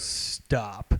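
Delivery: low, soft mock command; retold hushed order; imitated curt warning. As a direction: say it low and quiet, as the buddy. Buddy giving in after leg kick.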